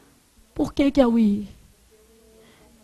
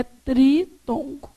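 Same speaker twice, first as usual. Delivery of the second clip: "tritongo" split as tri, tongo; syllable by syllable; much slower than usual. Shown as a demonstration. Teacher names the term for class, breaking it into syllables. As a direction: break "tritongo" apart with a pause.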